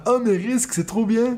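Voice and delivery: high, gushing enthusiasm; gushing fan. Retold or imitated. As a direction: mimic an overexcited fan. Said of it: "on te dit tout le temps".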